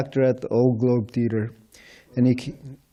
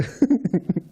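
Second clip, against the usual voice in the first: a short high chuckle; quick laugh; 10 semitones higher than usual. Real, not acted. Devotee laughing after his iron and gold answer, short laugh. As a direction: laugh lightly at your own quip.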